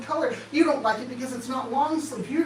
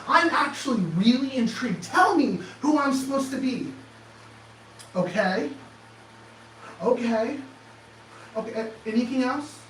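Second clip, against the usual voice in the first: usual speaking voice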